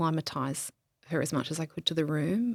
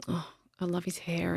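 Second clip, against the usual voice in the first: soft hushed whisper; 6 dB quieter than usual. Retold or imitated. Widow whispering tenderly over her husband's body, hushed whisper. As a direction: breathe it out, barely voiced.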